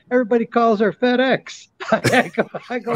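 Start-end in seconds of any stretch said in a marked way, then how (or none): 0.1-1.7 higher, a lightly voiced Rodney gag; Rodney Dangerfield voice. Joke told to friends in a higher light Rodney voice. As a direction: deliver it as a light Rodney punchline. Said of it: "He goes".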